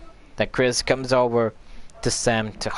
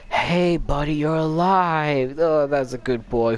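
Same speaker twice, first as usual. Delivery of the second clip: put-on high, slow doggy baby talk; 5 semitones higher, slower than usual. [name] finding the dog alive, in high slow baby talk. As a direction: coo at the dog, high and drawn out.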